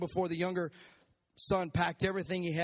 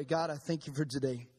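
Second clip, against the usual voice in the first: closing prayer; 4 semitones lower than usual. Acted. Pastor leads closing prayer.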